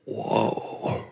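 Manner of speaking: breathless, hushed whoa in wonder; a whispered whoa of amazement; awed whoa. Retold or imitated. Looking down at his own body, a hushed awed whoa. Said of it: "going like".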